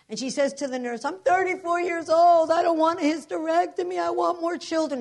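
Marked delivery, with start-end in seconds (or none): 1.0-5.0 loud, high imitated wail; hysterical. Roommate pleading with the nurse, imitated hysterical protest. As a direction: cry it out, high and drawn, as a distraught patient. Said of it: "And she's hysterical".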